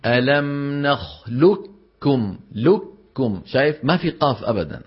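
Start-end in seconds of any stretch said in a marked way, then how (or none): 0.0-2.4 نخلقكم said slowly as نخلكم with doubled ك; no ق at all in نخلقكم; full merging. Shown as a demonstration. Teacher showing Arabs' full merging. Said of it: "فيقولون بكاف مشددة".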